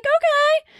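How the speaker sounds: very high, drawn-out mock disbelief; incredulous okay. Reading Mark Wahlberg news, high incredulous okay. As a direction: squeak it out in amused disbelief. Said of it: "I was like".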